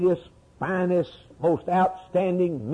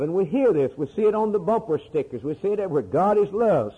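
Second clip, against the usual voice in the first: ordinary talk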